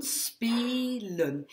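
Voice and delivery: spelen with spe drawn out, then len; long sound kept. Shown as a demonstration. Teacher showing the syllable cut, spelen with a long E. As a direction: hold the first E of spelen. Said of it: "je garde mon son long".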